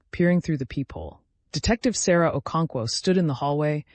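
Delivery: plain reading voice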